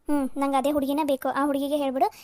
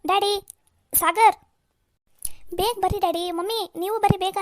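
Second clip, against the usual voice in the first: loud, raised calls to the family; urgent calling out; 4 semitones higher, 4 dB louder than usual. Acted. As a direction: holler for everyone to come quickly. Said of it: "ಹಿಂಗೆ ಒಂದೇ ಸಮನ ಒದ್ರಾಗ್ ಕುಂತ್ಬಿಟ್ಟಿಯಲ್ಲ".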